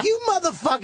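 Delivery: a high, indignant jab at a friend; mock outrage. Friend catching him copying the hat, mock outrage. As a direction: call him out, half laughing. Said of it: "And you'd be like".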